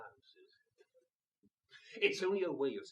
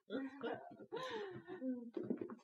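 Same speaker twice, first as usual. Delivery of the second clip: high laugh; 9 semitones higher, 9 dB quieter than usual. Acted. Long laugh after dismissing servants.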